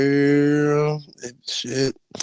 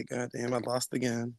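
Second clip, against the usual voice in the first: much softer, sleepy mock defeat; defeated mutter; 3 semitones lower, 13 dB quieter than usual. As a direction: sigh it out half asleep. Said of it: "I say".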